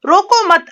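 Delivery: रोको मत joined, high and loud; no break between रोको and मत; रोको मत as one phrase. Shown as a demonstration. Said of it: "मत के बाद लगाया जाता है". (रोको मत एक साथ, ज़ोर से)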